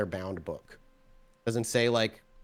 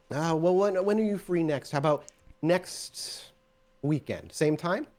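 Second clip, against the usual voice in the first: lightly raised, hesitant NPC voice; voicing the preacher NPC; 6 semitones higher, slower than usual. GM voices the preacher asking for a next date, lightly raised. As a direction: hem and haw as the preacher. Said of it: "and as he thinks".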